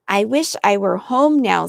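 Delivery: usual speaking voice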